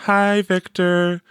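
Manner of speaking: high, slow, lightly voiced girl; sweet, slow girl's greeting. A narrator's reading. Teresa greeting Victor, high and slow.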